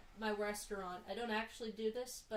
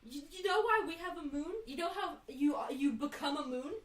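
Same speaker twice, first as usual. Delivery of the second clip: higher, louder heated lecture; exasperated explaining; 6 semitones higher, 5 dB louder than usual. Retold or imitated. Daughter to mom, heated exasperated explanation. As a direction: explain it with rising frustration. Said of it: "I got so angry".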